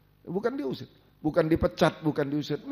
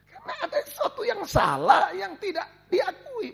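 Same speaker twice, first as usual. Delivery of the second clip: high, loud shout that slows on the last words; high pulpit shout, stretched at the close; preaching shout; 4 dB louder than usual. Real, not acted. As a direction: cry it out to the congregation, then drag the end. Preacher's outburst on hidden sin.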